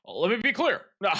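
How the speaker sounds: loud, high, hammed-up speech voice; mock a politician's press line; mock presidential. Obama impression bit.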